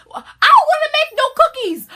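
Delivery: very high, loud, sassy whine; loud sassy put-on voice; sassy refusal. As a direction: refuse with full attitude.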